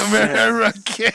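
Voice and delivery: usual speaking voice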